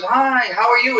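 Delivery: high-pitched mock hello; imitated friendly greeting. Retelling the other woman's greeting.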